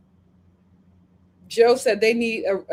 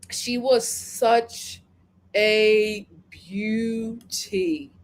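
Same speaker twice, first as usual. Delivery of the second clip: slow, drawn-out praise; admiring; 2 semitones lower, much slower than usual. Real, not acted. Host lingers fondly, slowly praising a contestant's beauty. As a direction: savor every word of the compliment.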